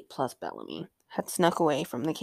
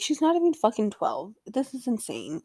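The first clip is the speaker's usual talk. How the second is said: normal talking voice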